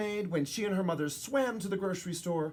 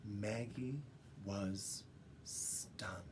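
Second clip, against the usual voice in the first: hushed; 7 semitones lower, 13 dB quieter, much slower than usual. A narrator's reading. Narrating her shock.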